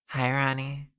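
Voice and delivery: soft whispery ASMR voice; breathy soft-spoken ASMR voice. Pretend. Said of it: "that's enough A S M R voice for me".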